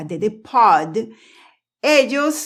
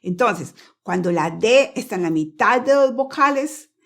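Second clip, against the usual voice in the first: ordinary delivery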